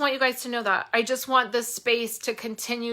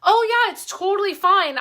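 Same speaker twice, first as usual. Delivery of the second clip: a high, bright, louder imitation of the easygoing customer; cheerful customer; 9 semitones higher, 4 dB louder than usual. Retelling a customer's reply, high and cheerful customer voice. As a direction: chirp it like a relieved, friendly customer. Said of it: "And she was like".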